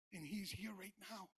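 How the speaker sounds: ordinary talk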